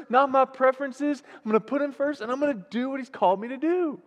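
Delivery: high-pitched, driving sermon climax; fervent declaration of resolve to the congregation; impassioned preaching. Real, not acted. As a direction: pile up each vow with rising fervor. Preaching at full force, rising declaration.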